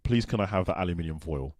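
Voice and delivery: plain British accent, aluminium with five syllables. Shown as a demonstration. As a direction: model the line in everyday British English. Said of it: "our regular accent".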